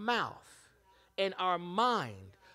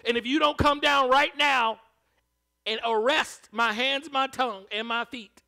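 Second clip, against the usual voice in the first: loud, raised, heated plea; honest angry prayer; 5 semitones higher, 12 dB louder than usual. Retold or imitated. Believer telling God their anger out loud. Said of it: "It's okay to say".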